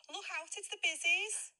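loud, high Liverpool shout of bizzies; Scouse warning. Acted. Scouser warns friends the police are coming, loud shout.

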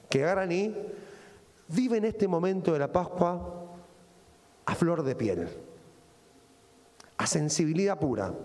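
usual speaking voice

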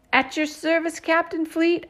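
high, crisp salute; loud and clear. A narrator's reading. Girl saluting an imagined captain at the window, loud and clear. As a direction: sound off like a cadet to the captain. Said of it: "I say loud and clear".

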